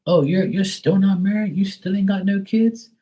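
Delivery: high, prying mock voice; high-pitched mock of busybodies asking about marriage; mocking nosy people. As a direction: needle him like a nosy auntie. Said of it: "Some people used to say that to me".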